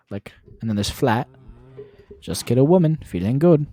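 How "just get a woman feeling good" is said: loud practice run of a British accent; amateur mock-British, pushed louder; attempted British accent. Shown as a demonstration. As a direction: give the British accent another go, loudly. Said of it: "we're gonna try this".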